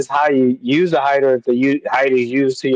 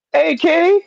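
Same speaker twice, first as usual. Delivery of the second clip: sudden squeaky high greeting; 3 dB louder than usual. Real, not acted. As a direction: pop the name up way high, surprised. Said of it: "Said in my high voice".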